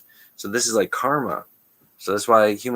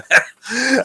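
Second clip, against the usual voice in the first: a sudden high burst of laughter, much louder; 8 dB louder than usual. Real, not acted. Laughing loud at his own idea of a son's channel.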